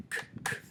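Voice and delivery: "k, k" said as bare voiceless clicks; isolated k sound. Shown as a demonstration. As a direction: tap out the "k" sound twice without voice. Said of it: "On entend le k".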